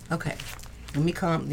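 ordinary talk, nothing marked

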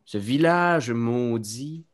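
slow, hushed spooky tale; story narration. Acted. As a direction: tell a dark tale slowly, softly. Clown tells his shadow story.

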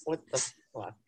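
soft, put-on grumpy cat voice; mock cat, hushed and annoyed; voicing the cat. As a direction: hiss it like a startled cat. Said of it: "the cat was like".

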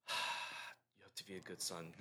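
low, slow, hushed self-reminder; quietly coaching yourself to patience; calming self-talk. Acted. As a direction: breathe out, then talk yourself down softly. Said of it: "stop and breathe sometimes and be like".

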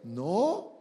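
ordinary talk, nothing marked

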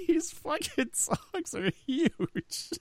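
helpless high, soft laughing voice. Real, not acted. Telling Dino about the delivery tongs, real laughing. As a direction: squeeze the words out slowly through laughter.

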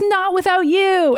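high, drawn-out mock-heroic refusal; lightly voiced melodramatic plea, stretched out; Kitty. Kitty refusing to leave Logan, mock-dramatic.

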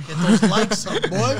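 loud laughing plug for likes; laughing shout-out. Acted. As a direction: hype it up with a laugh.

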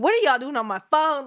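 calls out the couple on her phone, indignant and loud; indignant retort. Retold or imitated. Demanding to know who is on her phone, loud and indignant.

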